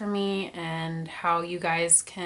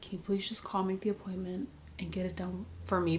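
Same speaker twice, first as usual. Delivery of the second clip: pleading whisper; 8 dB quieter than usual. Retold or imitated. Quietly pleading with the assistant.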